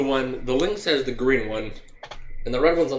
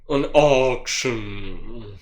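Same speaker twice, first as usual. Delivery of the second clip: slow sinister drawl; 5 semitones lower, much slower than usual. Acted. Menacing drawn out echo.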